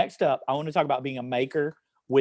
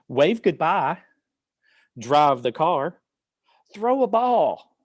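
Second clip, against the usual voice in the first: higher, slow, drawn-out action-poem reading; poem read to children; 6 semitones higher, much slower than usual. Acted. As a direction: lead children through each action, slowly.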